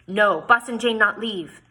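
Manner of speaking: insistent objection. Acted. Handsome Jim refuses to let Jane leave, loud and firm. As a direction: put your foot down.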